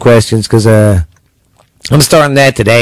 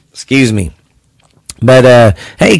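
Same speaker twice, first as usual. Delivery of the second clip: ordinary delivery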